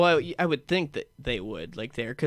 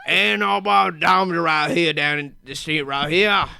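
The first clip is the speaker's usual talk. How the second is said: slow, louder, put-on thick Louisiana accent; 5 semitones higher, 9 dB louder, slower than usual. Mocking Southern speakers, in a slow, loud thick Louisiana accent. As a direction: drawl it out in a heavy Louisiana accent. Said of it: "that thick Louisiana accent".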